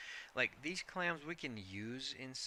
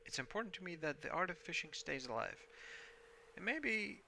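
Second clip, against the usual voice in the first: put-on higher, kindly old sea-dog; warm old fisherman Willy; 6 semitones higher than usual. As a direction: hand it over like a proud old fisherman.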